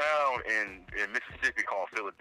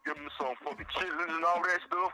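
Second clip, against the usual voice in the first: hammed-up country; 9 semitones higher than usual. Joking to his cohost, high mock country voice. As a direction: lay on the down-home country talk thick. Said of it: "trying to tell you how country we is".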